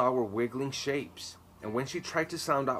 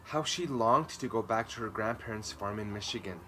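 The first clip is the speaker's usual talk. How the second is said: ordinary delivery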